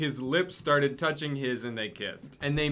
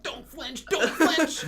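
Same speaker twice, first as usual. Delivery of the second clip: panicked; 14 semitones higher, 4 dB louder, slower than usual. Acted. Inner voice panic.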